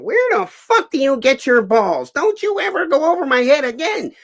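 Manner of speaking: very high, loud, furious tirade; full-force movie gangster outburst; Joe Pesci yelling. Retold or imitated. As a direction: yell it in a high-pitched rant. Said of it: "I start yelling at him like I'm Joe Pesci".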